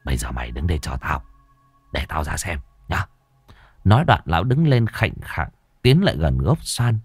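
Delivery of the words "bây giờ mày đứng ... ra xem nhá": low, hushed and fast; whispering in his ear. A narrator's reading. Môn Phọt whispering into Lâm's ear, low, quiet and fast. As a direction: hiss it quickly into his ear. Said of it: "Lão ghé tai Lâm Sẹo".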